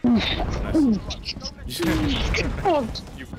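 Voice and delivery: high, laughing hype over a kill; excited laughing. Real, not acted. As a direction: cackle through a teammate's win.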